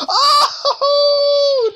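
very high loud gleeful cry; high delighted squeal; laughing shriek. Real, not acted.

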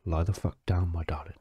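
normal talking voice

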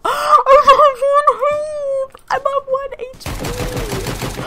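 high, slow, drawn-out fearful wail; panicked squeal. Real, not acted. Gamer squealing in fear on one health. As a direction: wail it out like you are about to die. Near death in game.

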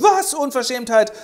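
a very high, loud shocked cry, then indignant; gasp, then spit the outrage; outraged shriek. Acted. Stepmother exposed by Helene, shrieking indignantly.

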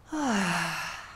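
long audible sigh of relief; cleansing sigh. Shown as a demonstration. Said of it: "Do one cleansing breath".

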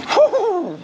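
high strained gasp from lifting; strained whew. Real, not acted. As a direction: blow out after a heavy lift. Lifting heavy box.

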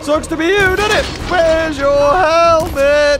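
high, loud, drawn-out mocking jeer; gloating taunt. Player to a beaten enemy, gloating taunt.

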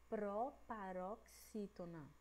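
proparoxítona slowly split, syllable by syllable; slow say-along. Shown as a demonstration. Teacher leading pupils to repeat, proparoxítona slow and segmented. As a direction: draw out each part of proparoxítona. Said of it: "Vamos lá de novo".

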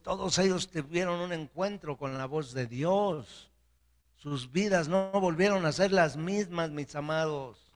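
high, ringing address to the congregation; forceful preaching. Real, not acted. Preaching at raised pitch.

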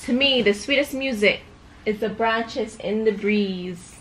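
put-on storybook voice for a little girl; reading to a child. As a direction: read it like a bedtime book. Reads picture book to child.

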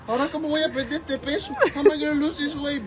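high, fast mock wail; hammed-up lament. Wife laments breaking her diet.

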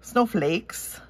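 snowflakes with a slipped-in Minnesota vowel; a touch of Minnesota on snowflakes; Minnesota accent. Real, not acted. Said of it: "my Minnesota accent popping out".

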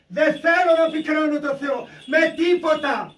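high, loud, slowly stretched declamation; forceful preaching. Acted. Believer vowing never to grieve a watching God, forceful preaching. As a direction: declare it with full conviction, drawing out the words.

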